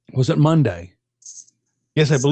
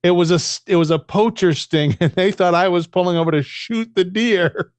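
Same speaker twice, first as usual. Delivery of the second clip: usual speaking voice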